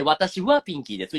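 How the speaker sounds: 私はピンキーです said fast, は leaned on; fast contrasting demo with は; quick は version. Shown as a demonstration. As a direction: rattle off with は. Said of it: "And if you say".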